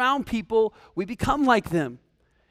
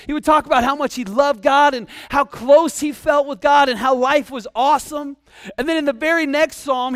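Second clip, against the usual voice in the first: normal talking voice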